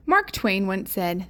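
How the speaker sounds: plain reading voice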